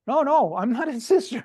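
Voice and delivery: high, laughing mock protest; protesting wife. Wife correcting her husband's doctor, high and laughing. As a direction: laugh off the mix-up, a little indignant. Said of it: "the wife's going".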